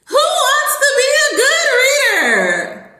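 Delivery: high, drawn-out excited call; loud, sky-high sing-out pitch to a pupil; hyped-up slogan. Pretend. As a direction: belt it out slowly like an over-the-top kids' teacher. Trying out a slogan for student.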